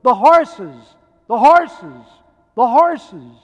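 loud, high, joyful cries; overwhelmed bystander shouting with delight; excited shout. Retold or imitated. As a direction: call out in sheer excitement at the parade. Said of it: "He shouted".

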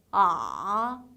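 아 dropping low, then lifting slightly; third tone. Shown as a demonstration. Teacher showing third tone, low dipping 아. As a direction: push 아 down low with a small bounce up. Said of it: "내려가는 느낌만 좀 강하게".

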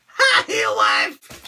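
very high, drawn-out silly hello; mock greeting. Greeting his wife for a fan, high mock squeal. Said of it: "Say hi to Heel Wife".

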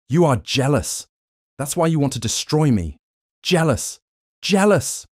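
rising panic as the guards grab him, yelling it again and again; frantic protest to frantic shouting. A narrator's reading. Jim dragged away by guards, shouting frantically at the doctor. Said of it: "protesting frantically".

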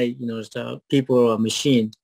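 usual speaking voice

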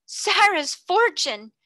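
horrified disbelief; shocked exclamation. A narrator's reading. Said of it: "she uttered the exclamation".

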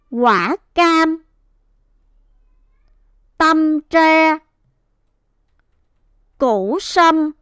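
measured two-word phrases, each syllable held; smooth whole-word reading. Shown as a demonstration. Teacher rereads the words for the class, whole and deliberate. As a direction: read each phrase whole, long pauses between. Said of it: "chúng ta sẽ đọc trơn lại các từ ngữ này".

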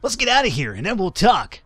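high-pitched surfer voice; high, swooping surfer character; surfer dude. Acted. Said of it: "gonna give him a surfer voice".

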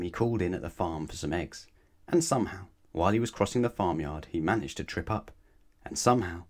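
usual speaking voice

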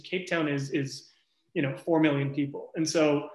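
normal talking voice

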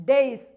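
ഡേയ്സ് said like days, one clear syllable; pronunciation demo. Shown as a demonstration. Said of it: "D A Y S pronounce ചെയ്യുന്ന രീതിയിലാണ്". (ഡേയ്സ് days പോലെ വ്യക്തമായി)

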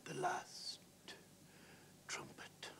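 halting, voiceless whisper. A narrator's reading. The dying man leans towards Robbie, whispering. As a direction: whisper it with a long break between words. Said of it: "lean over towards you and whisper".

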